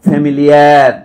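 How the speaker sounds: Familiar said out loud and apart as a single word; word shown loud. Shown as a demonstration. Lecturer naming the first word on the board, loud. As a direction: call out familiar as the first building block. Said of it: "শব্দটা লিখছে".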